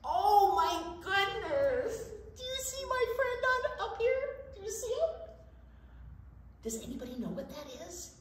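high amazed storytime voice; excited wonder. Acted. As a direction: call out to the children, full of wonder. Shows octopus picture to children.

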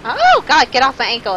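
loud, high startled outburst; freaked out. Real, not acted. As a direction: yell in alarm to shake it off. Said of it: "It freaks me out".

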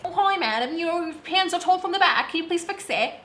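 high-pitched put-on British voice; mock British accent. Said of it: "I don't know why I turned British".

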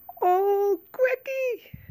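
high, overjoyed squeal; delighted cry. Acted. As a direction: cry out with sudden joy. Child finds lost toy.

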